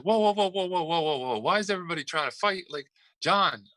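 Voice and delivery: rapid, high-pitched mock intervention; acted alarm. Retelling a coach breaking up a fight, high mock alarm.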